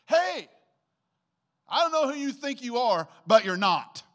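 shouted high and loud, putting someone in their place; loud scolding quote. Retold or imitated. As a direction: call out a troublemaker sharply. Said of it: "And she said".

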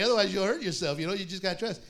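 normal talking voice

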